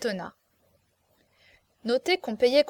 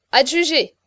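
loud, higher, eager call; eager haste; 4 semitones higher, 8 dB louder than usual. A narrator's reading. Steward closing the sale, eager haste. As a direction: jump in to close the sale. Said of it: "fit Pérolle avec empressement".

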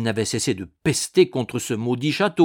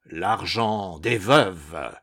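slow, deep hollow ghost voice; cavernous ghost voice; 5 semitones lower, much slower than usual. A narrator's reading. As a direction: drag it out from a tomb. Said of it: "d'une voix de plus en plus caverneuse".

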